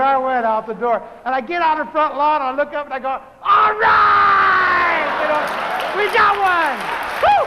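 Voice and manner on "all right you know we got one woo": loud, high whoop of triumph; excited cheer. Retold or imitated. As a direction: shout it to the sky, thrilled. Celebrating on the front lawn.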